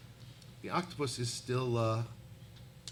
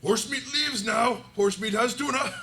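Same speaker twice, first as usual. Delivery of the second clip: triumphant creature voice; 10 semitones higher, 6 dB louder than usual. Acted. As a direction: announce it loudly as a simple-minded creature. Game master plays a creature.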